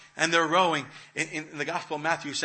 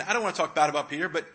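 ordinary talk

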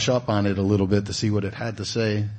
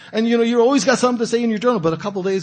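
fast, louder and higher, tumbling out; excited rush; 12 semitones higher, 6 dB louder, much faster than usual. Acted. Preacher mimics the eager new journal writer, fast and excited. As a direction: hurry on like it's all easy.

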